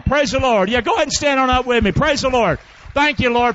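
loud, high exhorting preacher; preaching at full force. Real, not acted. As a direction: lift the room with a fervent call.

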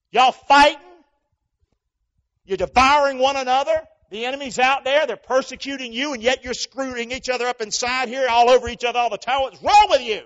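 loud, high rebuke; fiery preaching. Acted. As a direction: scold the congregation hard.

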